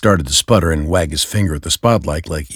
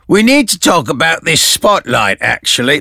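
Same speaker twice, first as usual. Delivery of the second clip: high, strained cop voice, pitched way up; a frazzled high-voiced Gordon cutting in; Gordon's voice; 9 semitones higher, 5 dB louder than usual. Gordon interrupting Dent and Batman, high strained voice.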